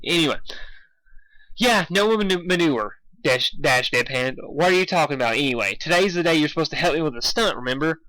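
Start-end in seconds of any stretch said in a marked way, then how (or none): none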